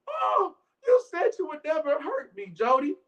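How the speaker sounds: high, hammed-up tearful plea; mock crying wife. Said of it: "Now she crying".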